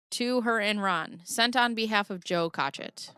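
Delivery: ordinary delivery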